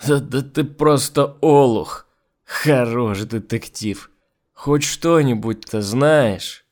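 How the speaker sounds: put-on slow, loud drunken taunt; drunk mocking. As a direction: jeer at him, drunk and slow. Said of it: "Кудимов пьяно рассмеялся".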